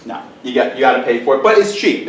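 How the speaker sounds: usual speaking voice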